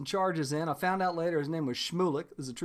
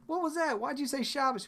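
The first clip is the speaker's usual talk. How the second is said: puzzled neighbor; 7 semitones higher, 5 dB quieter than usual. Retold or imitated. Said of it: "they're like".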